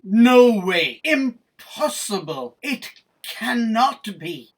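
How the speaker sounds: loud, slow, emphatic astonishment; amazed disbelief. Acted. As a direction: exclaim each phrase in stunned disbelief. Boat crew amazed at Peter.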